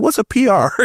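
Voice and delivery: lightly acted bafflement; naive puzzlement. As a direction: ask like your green younger self. His naive younger self, retold.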